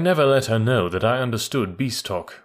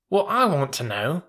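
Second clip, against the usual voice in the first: higher, youthful questioning voice; high-pitched young animal; young mule; 5 semitones higher than usual. Acted. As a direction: pipe up with a puzzled question.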